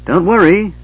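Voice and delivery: cheerful call. Acted. Tass calling up cheerfully.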